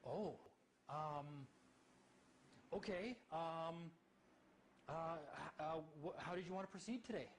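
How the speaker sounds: high, halting mock judge, hesitant ums; flustered judge. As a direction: play the judge suddenly unsure of himself. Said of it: "He goes".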